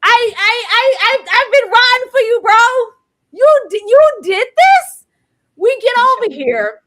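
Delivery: high, loud and wounded; hurt, like a friend stabbed you in the back; betrayed. Real, not acted. Loyal fan accusing Lestat, loud and betrayed.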